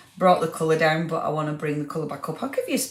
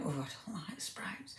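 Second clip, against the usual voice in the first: unvoiced whisper, much softer; whispered confession; 3 semitones lower, 19 dB quieter than usual. Real, not acted. Host whispering to viewers that she hates sprouts. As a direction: let it slip out as a secret whisper.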